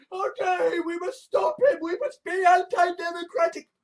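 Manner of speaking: high, whiny fake-sobbing outrage; mock whimper. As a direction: whine it like a crybaby. Mocking outraged critics.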